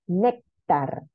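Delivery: Néctar split as NÉC, tar; teacher sounding out Néctar, first syllable stressed; stress on penultimate syllable. Shown as a demonstration. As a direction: lean on NÉC, let tar fall in Néctar. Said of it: "Segunda sílaba, penúltima".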